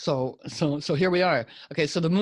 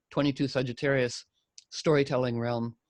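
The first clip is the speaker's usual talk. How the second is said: ordinary talk, nothing marked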